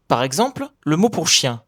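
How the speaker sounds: usual speaking voice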